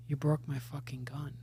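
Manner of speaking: whispered, devastated accusation; stunned whisper. Retold or imitated. Casting lady over her broken prop gun, in a stunned whisper. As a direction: hiss it out in shock.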